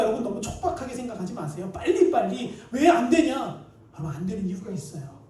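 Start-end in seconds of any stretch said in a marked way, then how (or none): 1.7-3.6 louder, mock impatience; acted burst of impatience; impatient demand. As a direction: demand it like someone who can't wait. Mimics impatient people demanding answers.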